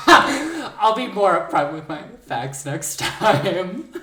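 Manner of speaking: slow words through laughter; laughing. Real, not acted. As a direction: talk while cracking up. Laughing at being caught.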